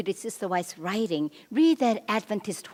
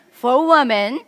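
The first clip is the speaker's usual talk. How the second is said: much louder, higher rallying cue; emphatic repeat call; 5 semitones higher, 11 dB louder than usual. Shown as a demonstration. Seminar speaker prompting the men to try again, emphatic loud call. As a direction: belt out "For women" with a rising end for the crowd to echo. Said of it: "let's try again".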